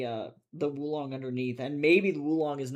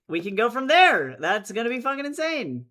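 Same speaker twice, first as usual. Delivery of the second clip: excited; 6 semitones higher, 9 dB louder than usual. Real, not acted. Excited about Canadian citizenship.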